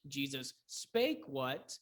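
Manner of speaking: slow, SPAKE drawn out; stress on SPAKE. Shown as a demonstration. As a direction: hit the verb spake. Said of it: "probably be a verb pattern".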